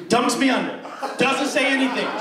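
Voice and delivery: ordinary talk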